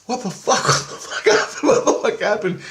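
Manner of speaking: high, loud stunned question; waking up and demanding of Chris what happened, high and loud; shocked disbelief. Retold or imitated. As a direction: blurt it out just woken, bewildered. Wakes up shocked.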